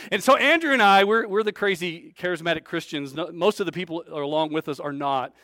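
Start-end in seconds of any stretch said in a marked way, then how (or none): none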